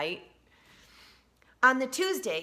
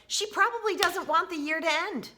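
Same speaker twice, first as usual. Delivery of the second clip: put-on high, loud little-girl voice; high child voice; 11 semitones higher, 4 dB louder than usual. A narrator's reading. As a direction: Olivia worried for her teacher. Child voiced in read-aloud.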